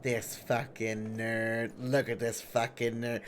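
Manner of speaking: quiet slow imitation of Zinda's disdain; unimpressed onlooker. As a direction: mutter it like a bored friend judging. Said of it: "Zinda's sitting there ... being like".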